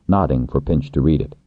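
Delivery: usual speaking voice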